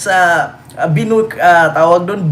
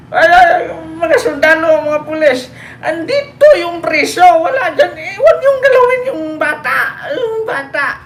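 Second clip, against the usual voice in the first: mocking Duterte pleading with police at the airport; pleading old man; 9 semitones higher than usual. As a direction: beg the soldiers like a frail grandpa.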